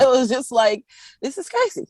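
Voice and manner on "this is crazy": high, amused disbelief; amazed reaction. Retold or imitated. Massage therapist recalling her shock at a client's story, amazed. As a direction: say it high, about to laugh.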